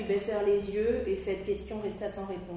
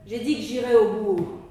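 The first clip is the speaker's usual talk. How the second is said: tags 1; 7 dB louder than usual. Acted. Nick insists firmly.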